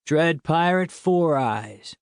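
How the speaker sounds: high, slow teasing pirate name; pirate-tinged schoolboy taunt; mocking pirate repeat. A narrator's reading. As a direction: draw out the nickname in mockery. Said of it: "Travis repeated".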